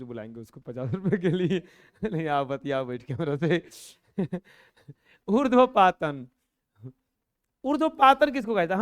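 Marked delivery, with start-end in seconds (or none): 5.3-8.8 calling out. Real, not acted. Teacher calls out to class.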